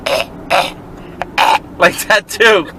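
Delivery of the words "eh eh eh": unvoiced-sounding croaked grunts; imitated Turkish manager. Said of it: "he talked like this".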